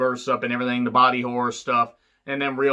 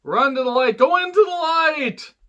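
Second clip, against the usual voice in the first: high, slow mock call into the light; 12 semitones higher than usual. Medium calling the little girl toward the light, high and drawn out.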